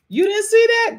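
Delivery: high, loud disbelief; incredulous. Real, not acted. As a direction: shriek it like you can't believe they missed it. Incredulous to viewers.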